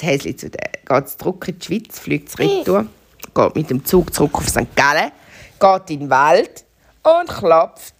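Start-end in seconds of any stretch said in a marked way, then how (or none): none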